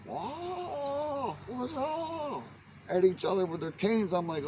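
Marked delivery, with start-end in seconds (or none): 0.1-2.4 much higher, squeaky taunt; mock challenge. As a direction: squeak out a silly old-man challenge. Old men dueling with canes.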